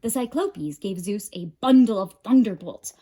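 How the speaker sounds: ordinary delivery, nothing marked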